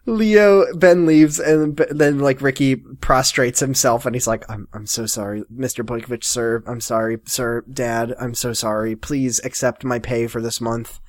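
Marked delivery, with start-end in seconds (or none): 4.5-11.0 soft, low, grovelling; grovelling apology. Retold or imitated. Ricky grovelling before Leo, soft low pleading. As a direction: beg forgiveness quietly.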